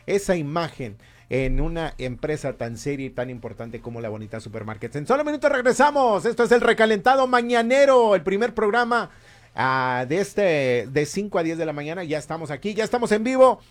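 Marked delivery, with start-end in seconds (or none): 4.9-13.6 loud, high, punchy on-air plug; energetic announcer read; radio host promo. Acted. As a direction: hype the show before the break.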